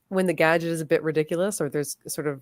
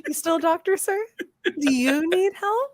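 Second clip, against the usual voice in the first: mock concern; 11 semitones higher than usual. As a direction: pretend to fret over him.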